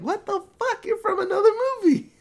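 hammed-up disbelief, laughing; mock shock. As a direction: squeal it like you can't believe it. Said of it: "They're like".

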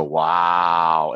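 low, drawn-out "Wow."; imitating someone's wow moment; mock wow. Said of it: "people go".

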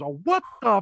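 startled. Retold or imitated. Big Mama's startled reaction.

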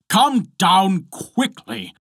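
high, drawn-out command; angry cry. A narrator's reading. Husband ordering his wife down, crying out. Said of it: "cried Bluebeard".

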